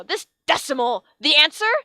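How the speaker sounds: loud, disgusted outburst; teacher disgusted by the decimal; hate and disgust. A narrator's reading. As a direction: spit out the word with disgust. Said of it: "said the decimal with hate and disgust".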